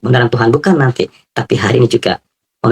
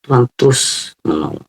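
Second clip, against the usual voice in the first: ordinary delivery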